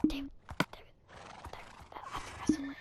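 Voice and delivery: hushed, urgent-sounding whisper to a friend; barely audible whispered retelling. Retold or imitated. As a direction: whisper it under your breath. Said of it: "we like to whisper a lot".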